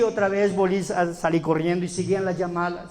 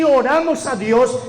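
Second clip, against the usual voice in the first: usual speaking voice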